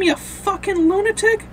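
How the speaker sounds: high-pitched outraged retort; high, incredulous anger; acted outrage. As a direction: act out an indignant outburst, pitched well up. Acted outburst from the comic.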